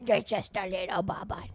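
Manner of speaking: whispered pet voice for the dog; whispered doggy voice for her pet. Real, not acted. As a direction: breathe it to the pup in her dog voice. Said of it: "that's my dog voice".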